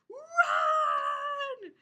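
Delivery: high, loud panicked shout; shouted warning. Acted. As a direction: yell at the crew to flee. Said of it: "said Captain".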